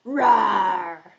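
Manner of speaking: big rough lion's roar. Shown as a demonstration. Teacher shows children the story cue, big lion roar. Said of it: "I'll say lion and you".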